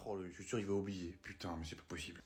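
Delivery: grumbling aside. Acted. Fed-up father muttering to himself after his son runs off. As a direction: grumble it under your breath.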